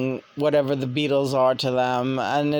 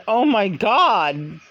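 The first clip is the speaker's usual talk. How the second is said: stunned shock; 5 semitones higher than usual. Retold or imitated. Stunned by the spirit speaking.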